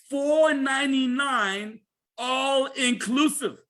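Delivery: slow, emphatic, drawn-out words; very slowly. Shown as a demonstration. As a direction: stretch out each word so it sinks in. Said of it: "I'll say that very slowly".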